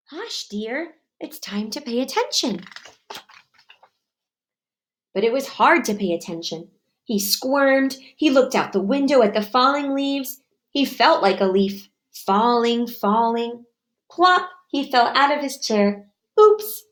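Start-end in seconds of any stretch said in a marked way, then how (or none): 0.1-2.7 much softer, gentle hush; calm a child down softly. Acted. Teacher hushing Froggy, soft and gentle. 16.4-16.9 high, loud yelp; sudden oops as he falls. Acted. Froggy falling out of his chair, high oops.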